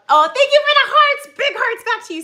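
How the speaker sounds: high, loud thanks; excited. Real, not acted. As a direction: burst of delighted thanks. Thanking viewers for hearts.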